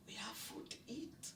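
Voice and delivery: soft hushed whisper, barely voiced; whispered to mom. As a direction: whisper it gently so only mom hears. Telling mom there is food.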